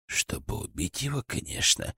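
smirking. A narrator's reading. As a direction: say it with a cold grin. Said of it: "усмехнулся Градов". (с усмешкой, тихо)

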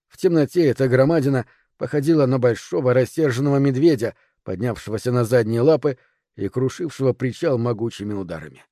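ordinary delivery

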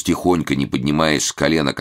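plain reading voice

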